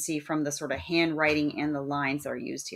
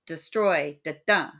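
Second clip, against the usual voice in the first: "destroy" and "da-dun" with the second syllable stressed; iambic stress; slower than usual. Shown as a demonstration. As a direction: lean on the end of "destroy", echo it as "da-dun". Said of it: "That's an iamb".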